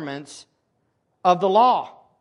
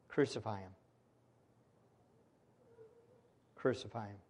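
quiet crucify; 8 semitones lower, 12 dB quieter, faster than usual. Retold or imitated. Crowd screamed, voiced quietly.

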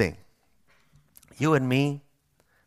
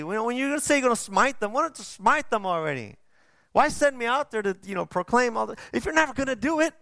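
high, exasperated complaint to God; whiny Jonah; 8 semitones higher than usual. Retold or imitated. Jonah whining at God over spared Nineveh, exasperated and high. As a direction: whine up at God like a sulking prophet.

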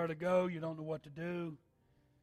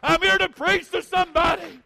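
shouted, much higher and louder preaching; preaching at full force; 12 semitones higher, 18 dB louder than usual. Acted. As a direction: preach it at the top of your lungs. Preacher at climax.

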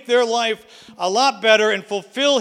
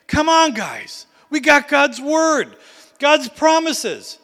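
rallying cry; 4 semitones higher than usual. Retold or imitated. Caleb urging the frightened people forward, rising and eager. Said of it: "he's like".

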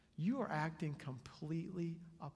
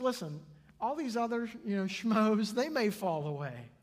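loud, raised-pitch boast dismissing the others; cocky Peter; 7 semitones higher, 9 dB louder than usual. Acted. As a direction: brag over everyone else's heads. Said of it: "Peter being who he is and stepping up".

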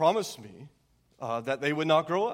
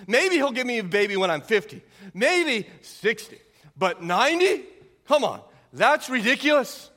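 imitating Sarah's incredulous scoff, pitched up; mock disbelief, building louder and higher; 6 semitones higher, 5 dB louder than usual. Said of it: "Sarah scoffed at his ability".

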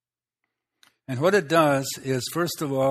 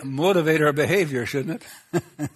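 laughing; 3 dB louder than usual. Real, not acted. Preacher laughs at own point.

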